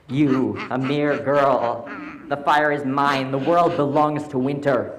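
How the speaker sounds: winter hag. Acted. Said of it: "The Kayach laughed".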